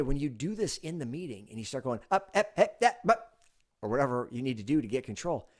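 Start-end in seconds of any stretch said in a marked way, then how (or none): 2.1-3.4 loud, high repeated cut-in; raised interrupting tone. Shown as a demonstration. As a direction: fire off sharp interjections to seize control. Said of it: "Don't stay up in that tone".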